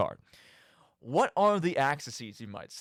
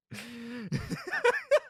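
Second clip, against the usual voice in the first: a quick, very high laugh at his own joke; high giggle. Real, not acted. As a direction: giggle at the taunt. Laughs after calling viewer sicko.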